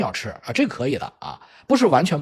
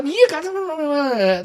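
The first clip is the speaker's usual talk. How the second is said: high, babbling mock outrage; tongue-tied protest; 10 semitones higher than usual. Ten-year-old confronts his sibling, mock-outraged and tongue-tied. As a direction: imitate a child sputtering into nonsense. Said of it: "他就说不到点儿上".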